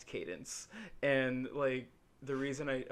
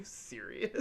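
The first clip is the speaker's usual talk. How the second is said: laughing; 13 semitones higher, 14 dB louder than usual. Retold or imitated. Mom laughing at his realization.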